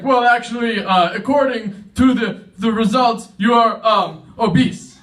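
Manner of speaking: high, halting doctor breaking bad news; hesitant doctor. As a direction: stall on every phrase before the verdict. Doctor stalls before the verdict.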